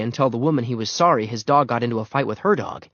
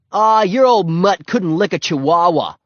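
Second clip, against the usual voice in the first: loud, high-pitched jeer; scoffing; 7 semitones higher, 7 dB louder than usual. A narrator's reading. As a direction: scoff at his dog, loudly. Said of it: "scoffed Scooter".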